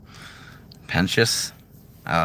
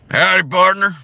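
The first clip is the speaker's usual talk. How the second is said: failed cowboy take; 13 semitones higher, 7 dB louder than usual. Shown as a demonstration. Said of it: "No, wait a minute".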